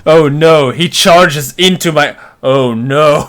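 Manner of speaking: loud, drawn-out playful horror; mock dismay. Player imagines the monster charging into him, mock dismay. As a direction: lament it with hammed-up dread.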